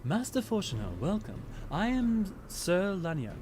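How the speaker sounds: formal, measured character voice with a lilt; earnest, stately gratitude; gracious noble knight. Acted. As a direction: greet an honored guest as a courtly commander.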